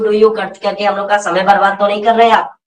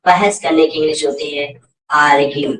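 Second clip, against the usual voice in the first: normal talking voice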